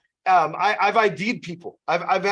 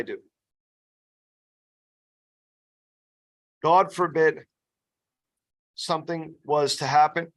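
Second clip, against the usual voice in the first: sincere and serious. Shown as a demonstration. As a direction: drop the joking and ask it earnestly. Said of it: "I get real with them".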